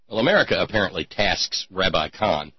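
ordinary talk, nothing marked

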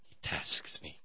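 breathy whisper. Retold or imitated. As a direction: whisper the line darkly. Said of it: "Star Trek reference".

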